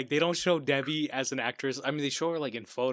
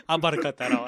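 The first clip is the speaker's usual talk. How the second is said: usual speaking voice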